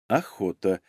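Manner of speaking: low, soft suggestive reply. Acted. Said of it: "чуть понизила тон Надежда". (понизив тон, тихо)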